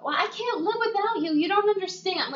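high pleading whine; mock clingy whine. Friend mimicking Debbie begging Blue, high whine. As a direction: beg like you can't live without him.

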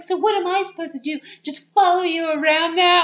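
high, loud, fast indignant outburst; hammed-up teen drama whine; mock outrage at the boyfriend; overacted heroine. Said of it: "all this overacting constantly".

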